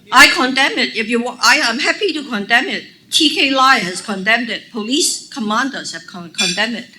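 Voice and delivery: loud, forceful declaration; emphatic rebuttal. Real, not acted. Politician answering accusation she never condemned triads, emphatic. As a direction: push back hard and loudly.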